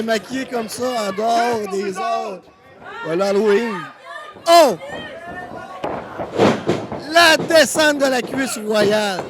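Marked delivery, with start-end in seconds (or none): 4.5-9.2 loud, excited ringside call; wrestling commentator. Acted. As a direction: hype up the big slam. Live wrestling play-by-play. (commentateur de catch excité)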